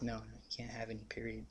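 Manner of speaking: hushed, breathless no, barely any voice; firm whispered refusal. Retold or imitated. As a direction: whisper it like a secret standoff. Refusing the security guards.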